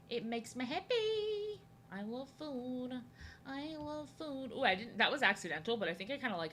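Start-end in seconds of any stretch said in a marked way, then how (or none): 0.1-4.5 slow, high, drawn-out singsong; high sing-song. Pretend. Streamer gushing about food, high sing-song. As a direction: croon it like a silly tune.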